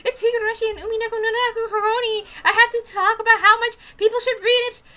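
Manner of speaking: high, loud, excited rush; gushing fangirl. Retold or imitated. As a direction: gush about it like a fan. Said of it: "being like".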